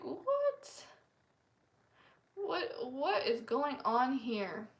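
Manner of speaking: high, startled what with the last line slowed; replaying her stunned reaction; shocked disbelief. Shocked by his personal questions.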